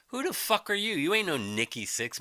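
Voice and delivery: normal talking voice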